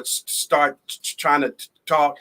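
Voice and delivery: imitating a childhood stutter; stumbling, repeating the first sounds. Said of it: "I stuttered severely".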